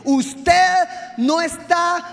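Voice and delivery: forceful shouted reprimand; booming, commanding correction; stern rebuke. Retold or imitated. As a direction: cut in loudly and lay down the law. God's stern rebuke, acted.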